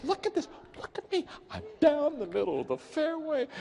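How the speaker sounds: high-pitched giddy boasting; excited. Retold or imitated. Terrible golfer showing his buddy his lucky drive, excited. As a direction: show off to a buddy in a high, thrilled voice. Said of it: "He's so excited".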